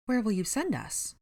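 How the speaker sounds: fretful mother's question; worried. A narrator's reading. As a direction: ask it with worry. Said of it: "Missus Creevy worried".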